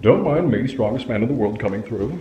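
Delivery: deep strongman voice. Acted. Strongman skit.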